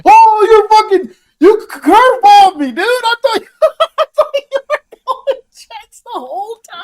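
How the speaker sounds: high, loud and laughing; shocked laughing outburst. Real, not acted. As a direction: caught off guard, cracking up. Friend shocked by the pick.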